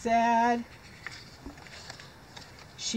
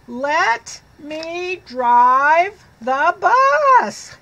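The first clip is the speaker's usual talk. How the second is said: loud, slow, drawn-out higher yell; yelling pigeon; 5 semitones higher, 7 dB louder, much slower than usual. Acted. Pigeon yells again at the reader, loud and slow. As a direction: stretch out a tantrum shout. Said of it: "He's yelling".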